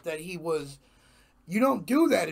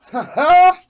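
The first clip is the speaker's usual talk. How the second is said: sudden high, loud laugh; bright burst of a laugh; 10 semitones higher, 13 dB louder than usual. Real, not acted. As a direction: laugh it off big. Laughs off his hoarse voice.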